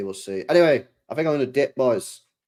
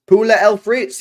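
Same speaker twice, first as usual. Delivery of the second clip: excited, loud and high Poulet et frites; 8 semitones higher, 8 dB louder than usual. Real, not acted.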